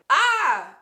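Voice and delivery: loud, high squeal with laughter; laughing scream of emotion; overwhelmed squeal. Real, not acted. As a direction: let out a big, high "ah!". Overwhelmed outburst at the card.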